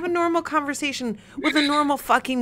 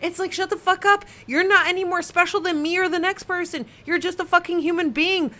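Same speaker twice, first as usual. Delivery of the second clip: real exasperated rant, pitched up; aggravated; 6 semitones higher than usual. Real, not acted. Ranting to co-host about people acting superior, aggravated and high-pitched. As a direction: let the irritation push your pitch high. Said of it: "I'm getting so aggravated".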